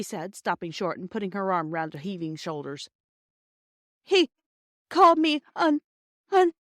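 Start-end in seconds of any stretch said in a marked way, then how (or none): 4.0-6.5 high, slow, halting sobs; child sobbing, much louder and higher. Acted. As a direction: choke out the words through tears. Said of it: "sobbed Phyllis".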